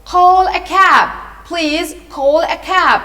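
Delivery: loud, high, slow call for help; high-pitched loud plea; crying out. A narrator's reading. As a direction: call out urgently, drawing out each word. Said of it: "the old gentleman with the gold spectacles cried".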